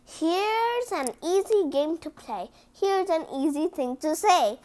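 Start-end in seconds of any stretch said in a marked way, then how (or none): none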